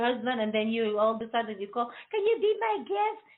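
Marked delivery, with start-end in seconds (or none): 2.1-3.2 much higher sweet pleading ask; chirpy mock invitation; hammed-up cheery caller; sing-song caller. Mock caller at one o'clock.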